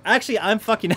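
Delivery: ordinary talk, nothing marked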